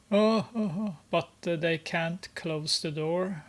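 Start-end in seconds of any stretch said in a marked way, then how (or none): 0.1-1.0 mocking oh oh oh. Mocking laughter continues.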